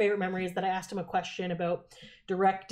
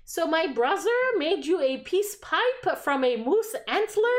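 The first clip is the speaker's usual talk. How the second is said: slow, much higher and louder; high excited fan voice; 8 semitones higher, 4 dB louder, slower than usual. Retold or imitated. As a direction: draw it out like a gushing fan. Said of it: "she's like".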